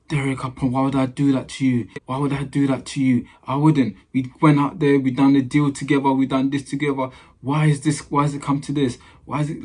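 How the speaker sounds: hammed-up tearful begging, imitating the agent; mock crying plea. Agent pleading with Derek, imitated as a mock crying plea. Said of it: "he put on the waterworks".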